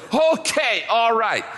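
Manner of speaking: high, loud okay, all right; delighted relief. Retold or imitated. Pastor reacting to the answered prayer, high delighted relief. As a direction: savour the good news.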